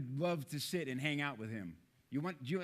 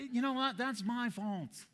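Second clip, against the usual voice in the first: mock gentle apology; 6 semitones higher than usual. Imitating Chris apologizing.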